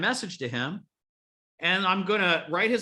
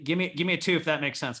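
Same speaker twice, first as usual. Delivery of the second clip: ordinary talk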